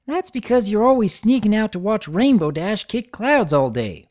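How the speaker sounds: filly teasing about Rainbow Dash; sly tease. A narrator's reading. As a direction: needle her with a knowing jab. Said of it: "Apple Bloom slyly said".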